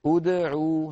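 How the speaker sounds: ادعوا with lips rounded only on the همزة and عين, light bounce on the د; correct gentle demo. Shown as a demonstration. Teacher shows the correct ادعوا lightly. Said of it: "هكذا".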